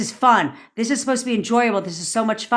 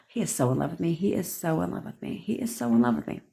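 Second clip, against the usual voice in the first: murmured, soft and low; softly chanted mantra; soft repeated affirmation; 3 semitones lower, 8 dB quieter, faster than usual. Shown as a demonstration. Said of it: "you need to look at it like".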